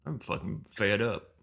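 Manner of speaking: put-on soft, grumbling southern accent; creepy southern accent. Co-host obliging with his Kentucky voice, creepy southern accent. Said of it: "You've gotta talk a bit like that".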